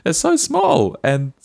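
high, slow, gushing delight; excited. Real, not acted. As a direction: gush about the tiny furious creatures. Gushing about honey badgers.

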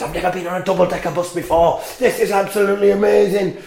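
lightly voiced, quick, excited child; a hint of a giddy kid gushing; excited little boy. Boy telling his mother about the bus, lightly voiced child excitement. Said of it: "And he says".

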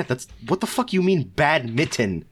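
normal talking voice

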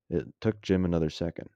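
ordinary delivery, nothing marked

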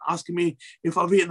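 ordinary talk

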